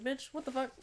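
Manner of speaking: high, sharp, incredulous; incredulous retort. Retold or imitated. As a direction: snap back in disbelief. Retorting to strangers.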